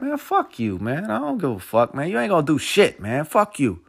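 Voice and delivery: retold defiance, pitch jumping up at the start; defiant retort. Teller replays cussing out his bully, defiant. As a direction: act out telling off a bully.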